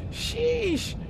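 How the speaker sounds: surprised. Real, not acted. Reacting to a hard landing.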